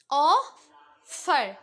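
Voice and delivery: ऑफर dragged out very slowly, split into its two syllables; slow classroom demo of ऑफर. Shown as a demonstration. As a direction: stretch ऑफर so both syllables ring apart. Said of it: "दोबारा से दो syllable".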